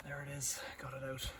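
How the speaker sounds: hushed whisper of relief. Real, not acted. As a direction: whisper it under your breath. Fishing a tool out with a magnet.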